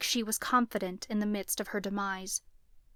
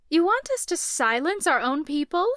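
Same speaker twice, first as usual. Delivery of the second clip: mocking; 7 semitones higher, 7 dB louder than usual. A narrator's reading. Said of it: "She was mocking me".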